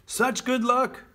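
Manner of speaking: high, loud neighbourly exclamation; excited cry. A narrator's reading. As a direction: cry out in delight at the good news. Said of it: "cried the neighbors".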